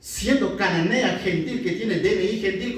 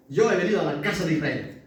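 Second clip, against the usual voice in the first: fast, tossed-off repeat; brisk paraphrase of the verse; quick restatement; 2 semitones lower, faster than usual. Shown as a demonstration. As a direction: rattle it off quickly as a recap.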